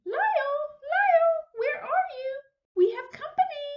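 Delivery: high, loud call through the house for Lyle. Acted. Lady searching the house for Lyle, calling high and loud. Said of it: "Missus Prim called again".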